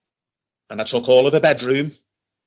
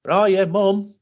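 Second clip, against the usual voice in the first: calling upstairs, raised and higher; calling out to mum; 7 semitones higher than usual. Retold or imitated. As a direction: call out to find mum in the house. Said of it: "So you hear me shout".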